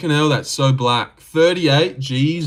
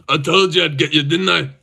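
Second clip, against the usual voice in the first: mock Scottish accent near natural pitch; 2 semitones higher than usual. Streamer demonstrating his impression of a gloating fighter, Scottish accent. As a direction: say it as a gloating put-on Scot. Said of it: "Scottish accent, by the way".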